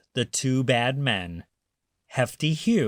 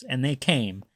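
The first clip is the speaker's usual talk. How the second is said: usual speaking voice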